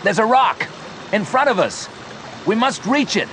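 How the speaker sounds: high-pitched urgent calling; shout. A narrator's reading. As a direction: shout directions in short bursts. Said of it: "shouted Joe".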